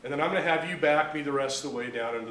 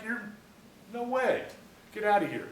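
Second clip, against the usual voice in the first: dismissive disbelief; 7 semitones higher than usual. Acted. Acted owner refusing the buyer.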